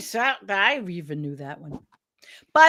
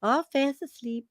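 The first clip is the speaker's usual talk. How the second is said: tender coo; 7 semitones higher than usual. Real, not acted. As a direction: coo over sleeping kittens. Cooing over sleeping cats.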